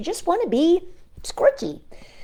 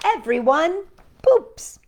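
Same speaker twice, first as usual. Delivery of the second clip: slow, a bit higher and louder title; announcing the title; 9 semitones higher, 6 dB louder, slower than usual. A narrator's reading. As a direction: present the book's title with flair.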